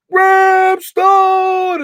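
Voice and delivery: big booming call of a name, high and loud; mock yell a name from behind; hammed-up booming shout of a name. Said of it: "with that big booming voice".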